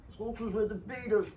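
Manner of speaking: half-hearted rock star mimicry, quickly given up. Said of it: "That was the worst [name] imitation ever".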